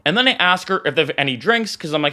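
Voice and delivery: ordinary talk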